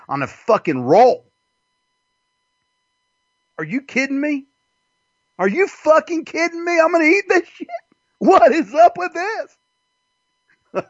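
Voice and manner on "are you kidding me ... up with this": high, rising disbelief, slowing at the end; shrill-free hammed-up indignation; mock outrage. Customer staring at a bad sandwich, high mock disbelief.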